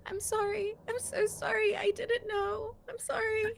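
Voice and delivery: high, tearful pleading, voice breaking into crying; sobbing apology. Acted. Megan sobbing sorry to the friend she is handing the lighter to. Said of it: "She's just sobbing and apologizing".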